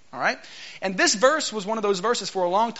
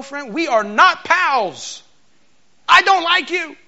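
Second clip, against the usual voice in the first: slow, loud and high, then louder still; hostile snap; 8 semitones higher, 6 dB louder, much slower than usual. Acted. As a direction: spell out the hostility word by word. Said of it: "that is not how it sounded".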